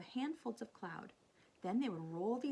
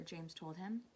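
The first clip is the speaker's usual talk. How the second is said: ordinary delivery, nothing marked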